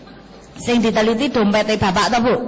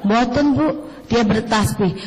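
usual speaking voice

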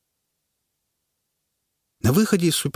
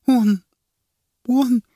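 high, loud broken stammer; sobbing, words stumbling out; stammering through tears; 6 dB louder than usual. Acted. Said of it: "Язык её заплетался". (жена запинается сквозь слёзы)

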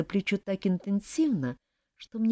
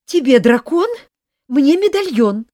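much louder, high gleeful yell; snatch the prize and crow about it; triumphant shout; 10 semitones higher, 12 dB louder than usual. Acted. Rival grabs the medallion, shouting gleefully louder and high.

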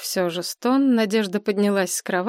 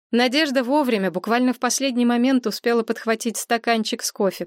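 ordinary delivery, nothing marked

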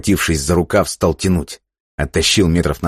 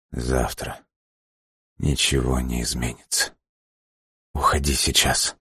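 faint whisper, barely voiced; a dying man's last words; weak hoarse whisper; 3 semitones lower, 6 dB quieter than usual. A narrator's reading. Dying man speaks faintly.

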